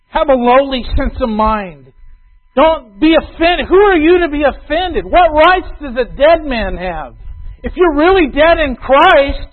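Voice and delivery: loud, high and slow pulpit preaching; full-force preaching. Acted. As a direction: drive the point home from the pulpit. Preaching climax.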